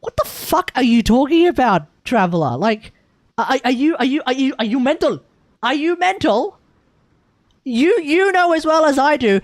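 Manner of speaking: loud, incredulous mock scolding; Paimon. Paimon scolding the Traveler, loud and disbelieving. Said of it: "Paimon would look at you and be like".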